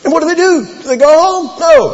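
high, loud rhetorical outburst; excited exclamation. Acted. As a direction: fire the question up high and answer emphatically. Excited mock question after the miracle.

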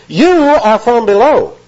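"You" stretched high and slow with strong emphasis; emphatic YOU. Acted. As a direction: lean hard on "You", drawing it out high. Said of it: "That's an emphatic".

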